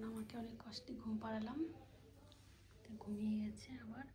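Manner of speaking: whisper. Real, not acted. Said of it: "আস্তে আস্তে কথা বলছি".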